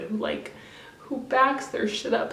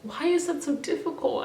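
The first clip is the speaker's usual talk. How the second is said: usual speaking voice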